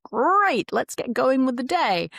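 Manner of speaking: high, loud, eager morning go-getter; eager Tony the Tiger. Acted. Tony the Tiger pumped up to start the day. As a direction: burst out like a cheery cartoon tiger. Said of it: "And he's, like".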